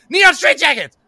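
high, loud band-name shout; excited shout. Shown as a demonstration. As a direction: yell the band name like an announcer. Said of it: "Exclamation point".

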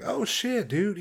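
coworker's high, excited "oh shit"; impressed surprise. Retold or imitated. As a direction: coworker thrilled to hear about stand-up. Coworker learns he does stand-up.

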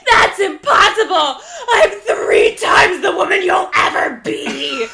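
a high, laughing, half-crying mock shriek; hysterical screech. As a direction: shriek it like a jealous drama queen. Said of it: "she's screeching, hysterical".

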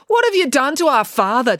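high, loud, indignant question; outraged demand. A narrator's reading. April to Loretta about Dad's makeover, demanding. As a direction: demand an answer, appalled. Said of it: "demanded April".

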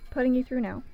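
ordinary talk, nothing marked

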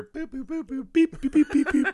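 high beeping robot noises; toy robot voice. Retold or imitated. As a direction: beep like an old computer. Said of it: "The Deborah Hill computer".

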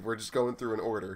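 normal talking voice

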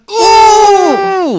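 high, loud offended "ooh" shriek; mock insulted mother's shriek; outraged shriek. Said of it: "she looks at me, it's like".

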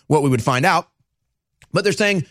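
normal talking voice

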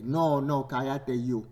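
loud, forceful comeback; defiant retort. Acted. Loud man refusing to be hushed, defiant shout. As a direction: turn the order back on them loudly.

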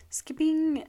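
high whispered aside; whispering. Real, not acted. Announces skipping questions.

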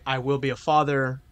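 usual speaking voice

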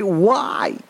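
ordinary talk, nothing marked

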